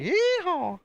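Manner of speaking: high, whooping American yeehaw; sudden high-pitched cowboy cry; cowboy holler. Shown as a demonstration.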